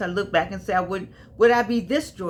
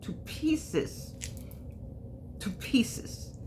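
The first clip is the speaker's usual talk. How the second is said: whispered; 10 dB quieter, much slower than usual. Real, not acted. Repeats love "to pieces", breathy.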